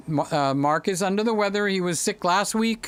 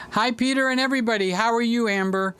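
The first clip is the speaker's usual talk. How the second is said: ordinary delivery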